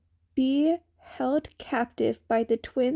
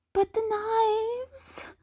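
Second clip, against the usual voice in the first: much higher, slow pleading question; innocent Kei; 11 semitones higher, slower than usual. A narrator's reading. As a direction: ask it slowly, all innocence.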